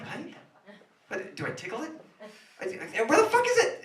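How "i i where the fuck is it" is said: panicked. Acted. Panicking mid make-out.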